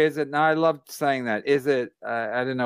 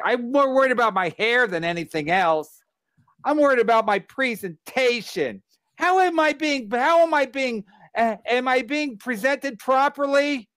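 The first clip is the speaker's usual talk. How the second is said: high, whining mock of a vain athlete; hammed-up prissy whine; whiny complainer; 8 semitones higher than usual. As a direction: sneer it out like a pampered star worried about his hair. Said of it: "he's always like".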